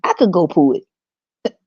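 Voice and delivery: slowed, mocking preacher cadence on the last words; churchgoer impression. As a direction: imitate the church folk preaching. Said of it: "they were like".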